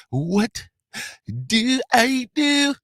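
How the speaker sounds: high, slow, drawn-out squeal; catchphrase squeal. Acted. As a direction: stretch it out, way up high. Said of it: "To everybody that be living it up".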